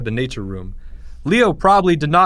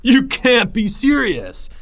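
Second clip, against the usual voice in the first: high, slow, incredulous bellow; 10 semitones higher, 3 dB louder, slower than usual. A narrator's reading. Greg answers chanting third graders, bellowing. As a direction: bellow it at the whole crowd. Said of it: "Greg bellowed".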